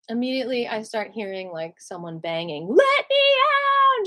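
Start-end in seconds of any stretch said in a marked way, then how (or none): 2.7-4.1 high, slow desperate yell; inmate screaming. Retold or imitated. Inmate banging on the cell door, high slow screaming. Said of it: "Lots of screaming".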